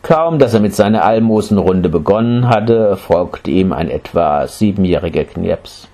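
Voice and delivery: plain reading voice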